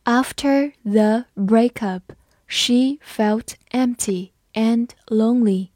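each word slow and clear; slow reading. Shown as a demonstration. Teacher reading slowly for students, slow and clear. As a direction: read it slowly for learners. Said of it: "好，我们慢读一遍。".

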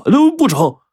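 high, loud, agitated protest; red-faced, flustered refusal. A narrator's reading. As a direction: blurt out a panicked refusal. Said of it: "脸红脖子粗地说".

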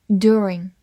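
American "during" with an r-coloured first vowel; American accent. Shown as a demonstration. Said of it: "或者美式发音".

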